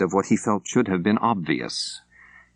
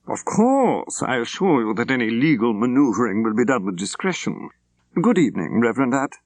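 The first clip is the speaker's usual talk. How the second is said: higher, prim clipped-free gentleman's voice; fussy English solicitor; 4 semitones higher than usual. Acted. Solicitor Woodcock thanking Reverend Apt, a higher fussy voice. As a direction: play the pleased little solicitor.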